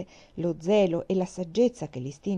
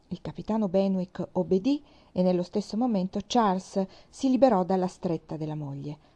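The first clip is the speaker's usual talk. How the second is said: ordinary delivery, nothing marked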